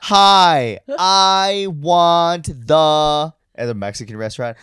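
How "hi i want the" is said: high, loud, very slow over-enunciated mom; mom talking to foreigners. Retold or imitated. Mimicking his mom ordering at a Mexican restaurant, loud and slow. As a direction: stretch every word like a mom ordering abroad. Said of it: "like your mom talks to people who she thinks are foreign".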